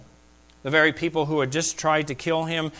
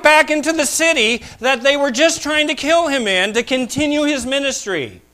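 higher, louder, driving sermon climax; full-force preaching; 10 semitones higher, 9 dB louder than usual. Acted. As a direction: build and hammer it home to the pews.

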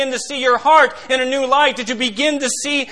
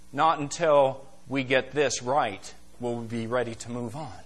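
quiet reply; 9 semitones lower, 9 dB quieter than usual. Retold or imitated. Preacher's pointed reply to the elders.

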